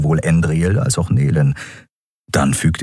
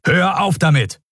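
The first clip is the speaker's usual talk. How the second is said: higher-pitched, sharp order; mildly raised voice; 11 semitones higher than usual. A narrator's reading. Admiral silencing the prisoner's complaint, mildly raised voice. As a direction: cut off the complaint with restrained force. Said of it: "Thelios Lautstärke ließ Nelen zusammenfahren".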